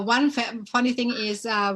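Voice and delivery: ordinary talk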